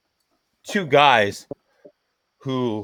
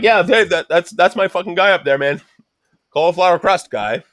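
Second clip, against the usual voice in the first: mock introduction; 5 semitones higher, 3 dB louder than usual. Mock pointing out crust guy.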